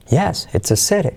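YES pitched high, repeated on CITY; high point repeated. Shown as a demonstration. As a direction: put the peak of "yes" onto "city". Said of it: "repetir en la palabra más importante". ("city" repite el punto alto de "yes")

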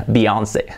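"Beyoncé" said as bi-ON-sey; English-style bi-ON-say; English pronunciation. Shown as a demonstration. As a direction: say Beyoncé with "bi" first. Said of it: "Así se pronuncia".

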